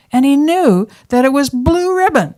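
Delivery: ordinary delivery, nothing marked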